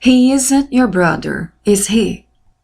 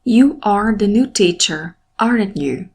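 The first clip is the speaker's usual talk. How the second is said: tag raised on "aren't", dropping on "you"; falling intonation. Shown as a demonstration. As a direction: confirm it, stressing "aren't" and letting "you" fall. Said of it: "A minha voz se elevou no verbo".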